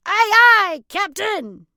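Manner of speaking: loud high aye aye; eager pirate reply. Acted. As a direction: answer the captain like a pirate. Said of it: "Use your best pirate voice".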